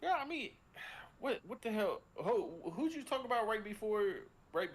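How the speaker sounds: a sudden high "wait, what?" as the thought slips away; sudden flustered surprise. Real, not acted. Host blanking on the earlier player, high startled surprise. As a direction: pitch jumps way up, caught off guard. Forgets who was discussed earlier.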